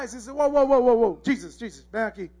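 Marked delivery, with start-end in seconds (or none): 0.3-1.2 loud, rapid string of protest; acted urgent objection; alarmed interruption. Peter interrupting Jesus to object. 1.2-2.3 confidential objection. Acted. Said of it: "Peter pulls him aside".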